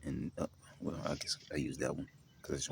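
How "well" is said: ordinary talk, nothing marked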